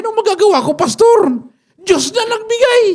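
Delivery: high, loud amazed outcry; a mother stunned her prayer came true; astonished mother. Acted. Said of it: "Gulat na gulat yung nanay". (gulat na gulat na nanay, mataas at malakas ang boses)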